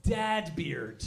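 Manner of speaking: ordinary delivery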